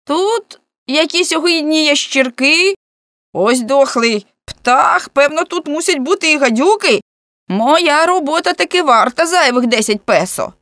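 high, loud complaining call; voice from the abyss. A narrator's reading. Man in the well complaining to those above, high loud call. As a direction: send each complaint up from the depths. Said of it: "немов з безодні".

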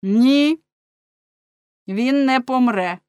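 loud, high, emphatic refusal; judge's pronouncement. A narrator's reading. Judge overruling the fishmonger, declaring loudly. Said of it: "сказав суддя".

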